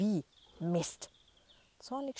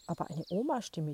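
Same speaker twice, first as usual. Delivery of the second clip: plain reading voice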